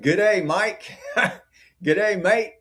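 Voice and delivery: playful high Aussie "Good day", pitched well up; mock Australian accent. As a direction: greet the chat like a cartoon Aussie. Australian greeting to chat again.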